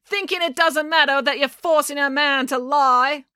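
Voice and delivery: slow, high, sustained heckle; calling out. A narrator's reading. April accuses the mayor from the crowd, calling out. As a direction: call the accusation across a crowd. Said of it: "called April".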